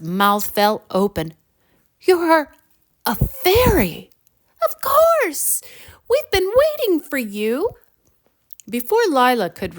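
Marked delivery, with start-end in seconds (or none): 2.0-4.1 amazed. Acted. Lila amazed question. 4.7-7.7 very high, tiny fairy voice. Acted.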